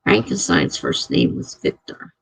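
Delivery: Frankenstein's first name was Victor, whispered to herself. Real, not acted. Rereading a viewer's comment to herself, whispered. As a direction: breathe it out as a whisper. Repeating the chat line under breath.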